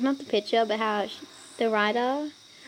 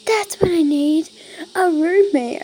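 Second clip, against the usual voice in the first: excited; 6 semitones higher, 9 dB louder than usual. Acted. Character's excited realization.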